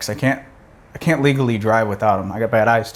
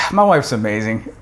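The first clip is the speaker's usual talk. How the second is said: ordinary talk, nothing marked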